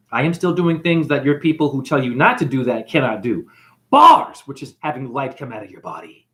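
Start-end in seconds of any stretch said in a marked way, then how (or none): 3.9-4.4 high, loud punchline shout; shouted catchphrase. Acted. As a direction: bark it out as a mic drop. Comedic catchphrase exclamation.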